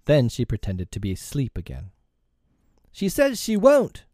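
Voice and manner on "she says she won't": high-pitched shocked report; aghast exclamation. A narrator's reading. Nibs reporting Tink's refusal to Peter, aghast. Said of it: "aghast at such insubordination".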